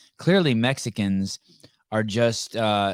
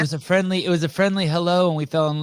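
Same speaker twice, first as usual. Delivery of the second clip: mocking sweet story voice; 8 semitones higher, 4 dB louder, faster than usual. Mocking sanitized origin story.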